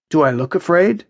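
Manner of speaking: nonchalant. A narrator's reading. Jack asked if he fears falling, nonchalant. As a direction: toss the question off casually. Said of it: "Jack says nonchalantly".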